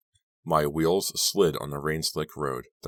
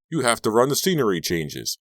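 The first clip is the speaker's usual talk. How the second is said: higher, louder urgent call; shouting after someone; 6 semitones higher, 5 dB louder than usual. A narrator's reading. Robbie calling the narrator back to scenery changes, shouted. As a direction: call out a demand after them. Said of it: "Robbie shouted after me".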